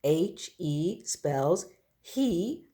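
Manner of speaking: H E, each letter said slowly and apart; teacher spelling it out slowly; spelled out letter by letter. Shown as a demonstration. Teacher spelling a word to young pupils, slow. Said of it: "First word to know is he".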